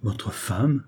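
ordinary delivery

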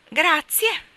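hint at the missing thanks; Mary Poppins nudging the starling to say «Grazie»; pointed thanks. A narrator's reading. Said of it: "disse Mary Poppins in tono allusivo".

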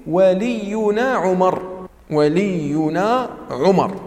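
slow, spaced recitation of the phrase; slow teacher's dictation; slow and clear. Shown as a demonstration. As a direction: spell out each syllable slowly. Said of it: "هذه الحروف مجموعة في قولهم".

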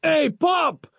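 high-pitched cry to get attention; calling out. A narrator's reading. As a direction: call out to your father, very high. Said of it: "he cried".

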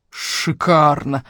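slow, drawn-out growl. A narrator's reading. Investigator fed up with the news, slow growl. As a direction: growl the word out slowly. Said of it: "прорычал он".

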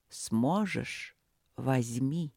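hushed, soft offer; quiet voice. Acted. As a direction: murmur it softly. Mystical voice offers crown again.

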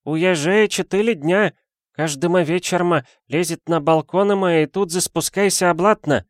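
put-on high, broken-Russian boy's voice; a young street boy chattering up high; Chinese boy.

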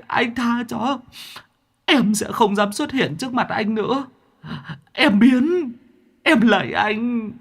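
high, whiny ghost begging; pitiful ghost pleading through tears; whimpering plea. A narrator's reading. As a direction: ghost whimpering, begging Mạc to stop hitting him. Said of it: "nó mếu máo van xin".

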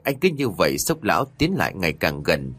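ordinary delivery

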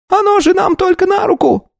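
very high, thin, piercing voice; squeaky voice. A narrator's reading. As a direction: squeak like a little clerk. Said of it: "писклявого голоса". (писклявый голос)

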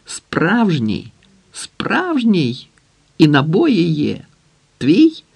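high, rising manic excitement; manic, high-pitched exclaiming; frenzied crying out. A narrator's reading. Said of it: "кричав".

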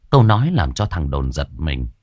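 ordinary delivery

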